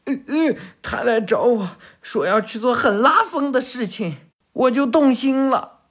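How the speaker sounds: put-on high, hurried, stammering grateful boy; timid underling. Defeated lackey thanking Sarada for healing him, high nervous voice.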